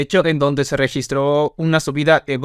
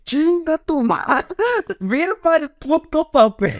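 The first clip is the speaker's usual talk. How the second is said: high, slow, drawn-out mock yell; retold furious outburst at the other lawyer; furious curse; 11 semitones higher, slower than usual. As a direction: let the insult explode, stretched and pitched up. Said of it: "se le deja ir".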